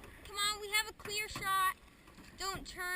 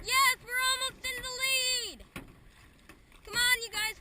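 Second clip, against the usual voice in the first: loud, higher rallying cheer; excited cheering; 5 semitones higher, 8 dB louder than usual. Real, not acted. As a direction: cheer the crew on loudly. Racing other canoes, cheering team.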